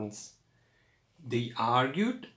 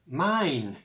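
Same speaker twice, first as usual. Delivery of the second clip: a mild shout rising higher on the second mine; 6 semitones higher, 4 dB louder than usual. A narrator's reading. Nigel grabbing the moon from Abel, mild shout. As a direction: insist harder the second time. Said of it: "shouted Nigel".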